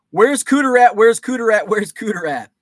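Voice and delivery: loud chant fading down; calling out. Pretend. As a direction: call it out, then let it fade. Calling out to chat member.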